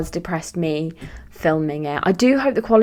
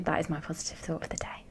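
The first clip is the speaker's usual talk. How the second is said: whisper; 2 semitones lower, 14 dB quieter, faster than usual. Real, not acted. Whispered sign-off line.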